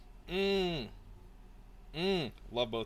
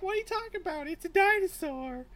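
much higher, louder put-on girl's voice; insistent lab assistant; 6 dB louder than usual. Retelling the scene, she voices the assistant insisting, much higher. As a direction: squeak out the obvious, exasperated and certain.

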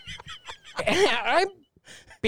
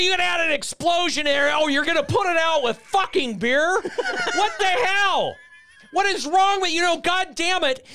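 imitating the raging boss while cracking up; laughing mock rant; 13 semitones higher than usual. Boss mocking the beer plan, high laughing mock rant. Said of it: "he's gonna".

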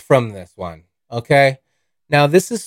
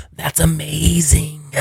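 breathy whispered wonder; awed whisper; 4 semitones higher, 5 dB quieter, slower than usual. Retold or imitated. High driver marveling at the U-turn, whispering. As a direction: whisper it, dazzled. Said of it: "I was like".

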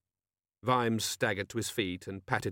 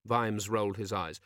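ordinary delivery

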